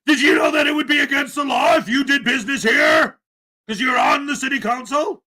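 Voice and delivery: hammed-up lawyerly grilling, pushing hard; mock auditor. Vlogger mimicking the auditor grilling the councilman, loud and mocking.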